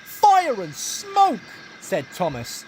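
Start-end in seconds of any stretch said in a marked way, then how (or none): 0.0-1.4 high, slow cry of alarm; dismayed exclamation. A narrator's reading. Engine crashes, exclaims.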